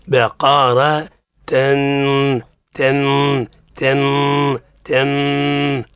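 slow repeated nasal "تن" after "بقرة"; ghunna drill. Shown as a demonstration. Teacher drilling the ghunna syllable slowly. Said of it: "من أول مقطع".

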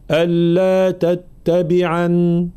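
ألا تتبعن ending on a still nun, slow; pausal form. Shown as a demonstration. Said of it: "فإذا وقفنا عليها نقول". (الوقف على نون تتبعن)

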